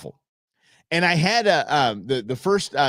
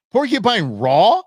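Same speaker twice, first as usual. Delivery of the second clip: incredulous question; 5 semitones higher, 6 dB louder, slower than usual. Real, not acted. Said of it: "Did you really say that?".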